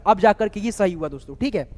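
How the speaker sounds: ordinary talk, nothing marked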